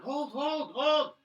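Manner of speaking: high, loud repeated command; shouted order. Acted. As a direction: yell it to hold the line. Soldiers called out on the wall.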